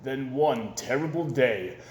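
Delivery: plain reading voice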